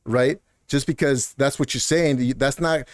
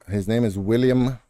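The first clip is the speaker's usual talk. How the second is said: ordinary delivery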